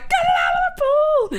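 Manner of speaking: very high, loud urgent shriek; retold panicked yell. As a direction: scream the order in panic. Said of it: "my first reaction was like".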